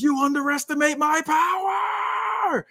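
high, slow shouted villain line; anime villain. Acted. Powered-up wrestler warning foes, slow shouted villain voice. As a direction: boom it like a cartoon villain. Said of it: "turns in another direction and says".

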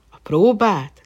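loud, high astonished echo; amazed question. Acted. Prince astonished by the deer's words, loud and high. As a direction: gasp the word out in wonder. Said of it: "kérdezte ámultan Rúzvánsád".

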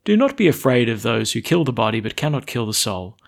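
ordinary talk, nothing marked

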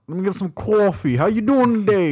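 put-on Brooklyn accent. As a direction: greet like a New York barista in Brooklyn accent. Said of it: "heavy Brooklyn accent".

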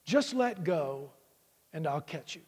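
calm low divine reply, softening at the end; voice of God. Acted. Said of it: "And the voice says".

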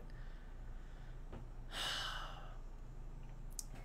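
long, weary unvoiced sigh; heavy sigh. Real, not acted. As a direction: let out a long tired breath. Venting grief about lost studio.

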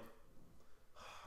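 long, quiet sigh of exasperation; exasperated sigh. Retold or imitated. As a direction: let out a weary, soft sigh. Exasperated by girl following him.

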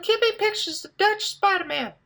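very high mock woman's voice. Retelling the female boss's order, very high mock voice. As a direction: squeak it way up high to imitate the boss as a woman. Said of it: "But it's a woman, so it sounds like".

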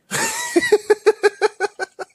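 high squealing laugh; real high-pitched laughter; laughing at own joke. Real, not acted. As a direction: crack up in a long, high cackle.

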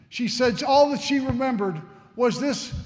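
normal talking voice

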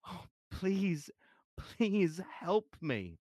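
high, very slow sobbing plea. A narrator's reading. Mother begging the priest for help, slow and sobbing. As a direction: beg through the sobs, drawn out. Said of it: "sobbing convulsively".

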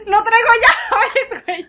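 slow, high, laughing through the words; laughing quote. Retold or imitated. Quoting her brother about keys, laughing slowly. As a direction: quote him while cracking up.

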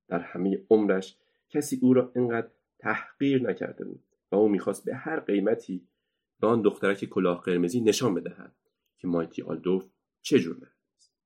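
plain reading voice

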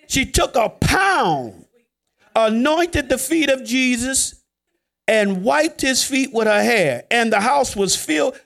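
slowed, weighty Scripture telling; slow dramatic telling. Real, not acted. Preacher slowly dramatizing the anointing for the church. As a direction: lean into each phrase, slowly.

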